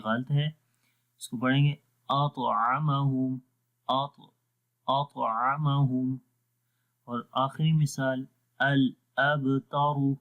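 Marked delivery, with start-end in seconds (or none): 2.1-6.1 「اطعمہم」をとてもゆっくり、طを軽く; gentle recitation. Shown as a demonstration. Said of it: "تو آرام سے پڑھیں گے". 8.6-10.1 「الابتر」のبを弱く、とてもゆっくり; very slow الابتر with light qalqala on ب; slow recitation. Shown as a demonstration. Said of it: "ٹھیک ہے، آرام سے".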